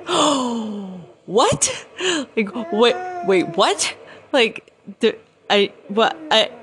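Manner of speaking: high, loud, stammering surprise; reenacted shock, pitch jumping up; stunned disbelief. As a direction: stammer as if caught totally off guard. Retold shock at being first up.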